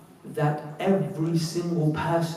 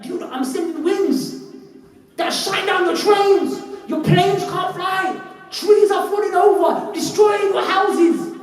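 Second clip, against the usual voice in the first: booming warning; 14 semitones higher, 7 dB louder, slower than usual. Retold or imitated. Allah voiced warning people.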